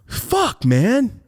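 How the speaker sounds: high-pitched exasperated curse; mock wail, falling away; retold frustration. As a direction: cry out as if just caught. Retold reaction to being caught.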